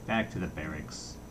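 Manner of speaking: a mock-military stern command. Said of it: "he said sternly".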